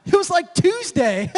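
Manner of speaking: laughing through the words; high, loud laughing disbelief. Real, not acted. Laughing at Solana outage news.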